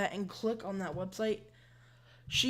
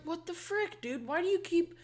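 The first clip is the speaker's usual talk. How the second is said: high-pitched mock outrage; sarcastically baffled retort; sarcastic; 9 semitones higher than usual. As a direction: play up fake bewilderment, pitch way up. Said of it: "I was, like, being sarcastic".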